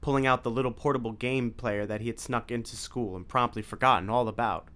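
plain reading voice

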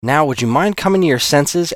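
high, loud demand. Acted. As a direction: insist he snap out of it. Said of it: "Alex demanded".

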